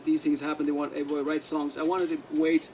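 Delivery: ordinary talk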